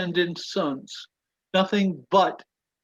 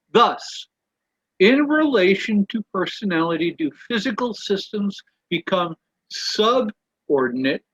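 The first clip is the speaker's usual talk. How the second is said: slightly raised, measured reading voice; reading aloud; 3 semitones higher, 4 dB louder than usual. Acted. Stuart reading a quote to the study group, measured and deliberate.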